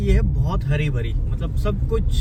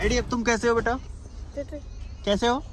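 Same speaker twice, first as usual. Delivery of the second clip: high, soft coaxing to the sick dog; 7 semitones higher, 5 dB quieter than usual. Real, not acted. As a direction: ask your sick dog gently if he's okay. Gently asking sick dog. (बीमार कुत्ते से प्यार भरी, ऊँची, नरम आवाज़ में पूछना)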